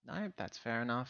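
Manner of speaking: ordinary talk, nothing marked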